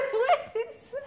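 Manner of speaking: laughing. Real, not acted. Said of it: "laughing at his accent".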